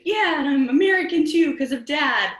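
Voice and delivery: put-on high, loud kid's comeback; excited child. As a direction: blurt it out like a thrilled child. Child's triumphant reply to grandmother.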